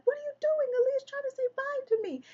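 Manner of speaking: high, sharp scolding shriek; exasperated scolding. Retold or imitated. Mother scolding son for interrupting goodbye, high sharp scold. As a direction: scold the boy in a high, cutting voice. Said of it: "and I'm like".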